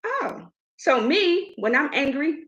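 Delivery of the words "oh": high, soft retold "oh"; understated, quietly stunned reaction; quiet anger. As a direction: hold the anger back in a small voice. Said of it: "I get quiet".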